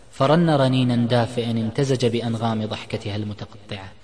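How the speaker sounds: ordinary delivery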